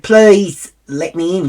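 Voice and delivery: emphatic plea. Retold or imitated. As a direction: plead slowly, leaning hard on the words. Retelling her plea at the gate.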